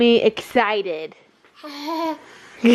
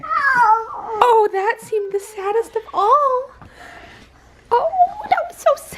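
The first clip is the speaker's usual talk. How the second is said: high, mock sobbing; mock crying; 10 semitones higher than usual. Mom copying her baby's fake cry, whimpering high.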